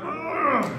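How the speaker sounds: mock old man groan; old man grunt. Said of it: "Then when you get up".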